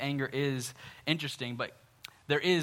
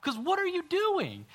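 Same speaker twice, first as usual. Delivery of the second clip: much higher, strained mock outrage; mock angry Jonah; 13 semitones higher than usual. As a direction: squeal it like a sulking prophet. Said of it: "He has the audacity to say".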